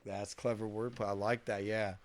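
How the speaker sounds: normal talking voice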